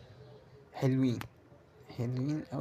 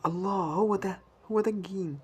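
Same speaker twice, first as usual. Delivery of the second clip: higher, louder joyful cry over a big win; excited cheer; 6 semitones higher, 6 dB louder than usual. Real, not acted. Cheering at the slot screen over a big win, excited. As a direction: shout for joy as the win lands.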